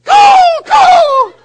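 full-force shrieked caw, very high; ear-splitting bird squawk; crow call. Retold or imitated. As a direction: snap and yell a crow call. Repeats the judge's crow call, snapping.

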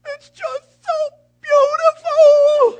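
high, loud, slow mock sob; imitating crying child. Six-year-old son at Christmas, mock weeping loud and high. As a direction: wail it like a weepy little boy. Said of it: "he goes".

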